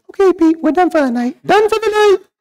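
squeaky high pitched pained dad; Dad's high strained voice. Retold or imitated. Dad squeaking after a ball to the groin. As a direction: strain it up high after the hit below the belt. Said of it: "Dad would stand up and say".

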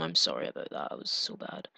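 breathy whispered apology. Real, not acted. Player whispers sorry to the one he failed, whispering. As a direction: whisper it, ashamed of the blunder. Apologises after bad split.

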